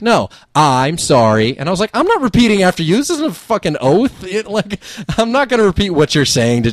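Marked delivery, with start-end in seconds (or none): 0.0-1.6 slow, drawn-out mock apology demand; demanding correction. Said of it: "She's like". 1.9-6.7 high-pitched, exasperated retort; indignant refusal. Retold or imitated. Retold refusal to repeat apology.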